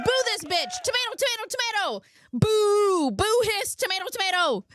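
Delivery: shrill high cheer and boo; high booing shout. Acted. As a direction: boo the villain at the top of your voice.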